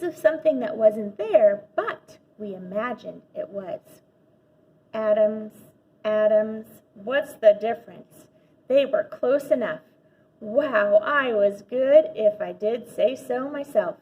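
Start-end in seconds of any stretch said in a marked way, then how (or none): none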